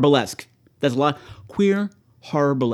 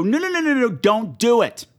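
frantic screen warning; 7 semitones higher than usual. Acted. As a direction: plead with the character on screen. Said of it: "point at the screen ... and go".

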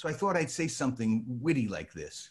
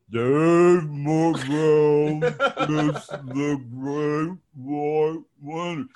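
very slow and drawn; 3 semitones higher, 7 dB louder, much slower than usual. Retold or imitated. Teen stretching his line out slowly for the host. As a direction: stretch each word out very slowly. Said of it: "say it a little lower".

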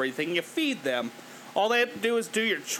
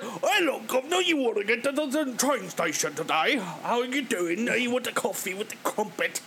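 hammed-up cockney cartoon Brit; cartoon British accent; 3 semitones higher than usual. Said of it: "overweight cartoon British person".

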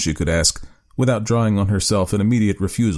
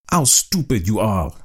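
ordinary delivery

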